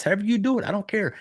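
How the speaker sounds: ordinary talk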